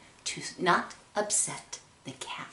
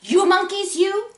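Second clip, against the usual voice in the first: raised, higher-pitched telling-off; scolding; 10 semitones higher, 5 dB louder than usual. Acted. Peddler shakes a finger at thieving monkeys, scolding. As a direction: scold them like naughty children. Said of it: "shaking a finger at him".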